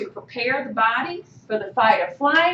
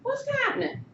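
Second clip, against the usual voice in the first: high whispery patronising calm down; high, soft, mocking calm-down voice; mock friend calming; 13 semitones higher, 9 dB quieter than usual. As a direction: imitate an annoying friend soothing you. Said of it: "they keep going".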